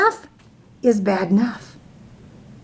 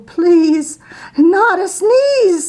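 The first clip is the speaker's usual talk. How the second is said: pleading bear; 9 semitones higher, 5 dB louder, slower than usual. Acted. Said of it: "said the bear".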